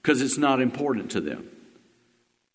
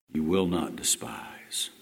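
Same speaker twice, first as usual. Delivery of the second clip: whisper; 8 semitones lower, 6 dB quieter, slower than usual. Acted. Whispered end of the psalm.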